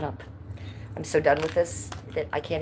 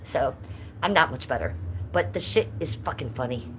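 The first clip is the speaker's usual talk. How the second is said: ordinary delivery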